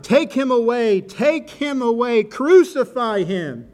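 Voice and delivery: loud, high, insistent chant-like cry; crowd shout. A narrator's reading. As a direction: shout it slowly, hammering each demand. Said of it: "And they shouted".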